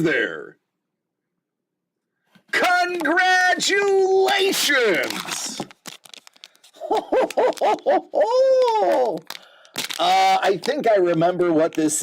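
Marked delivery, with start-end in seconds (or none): 2.5-5.6 high, slow, stretched-out cheer; mock announcer congratulations. Host hamming up a win for viewers, high and slow. Mock celebration over a card. 6.8-9.4 high, falling laugh; high giggle. Real, not acted. Streamer laughing at his own bit, high giggle. Laughs after mock congratulation.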